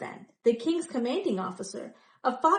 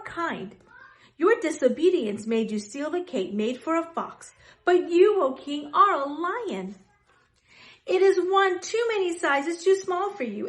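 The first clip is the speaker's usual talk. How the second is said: louder, firm scolding of the king; bold rebuke; 5 semitones higher, 5 dB louder than usual. Acted. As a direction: stand up to the king boldly. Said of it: "came boldly to the king".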